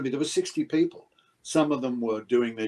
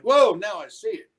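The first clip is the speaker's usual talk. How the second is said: mock surprise; 8 semitones higher, 6 dB louder than usual. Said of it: "it's like".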